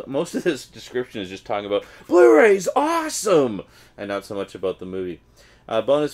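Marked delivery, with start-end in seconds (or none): 2.1-3.6 high, loud mock excitement over "Blu-ray's awesome!"; mock hype. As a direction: shout it like a cheesy ad fanboy. Mocking the Blu-ray blurb.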